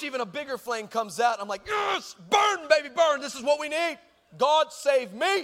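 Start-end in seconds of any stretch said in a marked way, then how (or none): 1.7-5.4 high, loud retold exulting; mock triumphant cheer. Boy cheering the burning horse, mock triumph. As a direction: cheer on the flames, hammed-up. Retells cheering the bigger flame.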